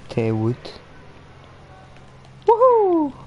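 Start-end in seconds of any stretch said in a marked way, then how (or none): 2.5-3.1 high, loud whoop; excited cheer. Real, not acted. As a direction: whoop in triumph. Cheering in game.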